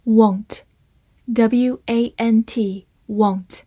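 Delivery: want said with a short open O vowel and spelled out slowly. Shown as a demonstration. Teacher spelling out want for learners, short O vowel. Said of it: "字母A发短音O".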